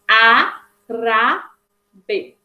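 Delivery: "A, Ra, B" as three separate beats; teacher slowly spacing out "A, Ra, B"; syllable by syllable. Shown as a demonstration. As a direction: break "A, Ra, B" apart syllable by syllable. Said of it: "Se a gente fosse dividir assim".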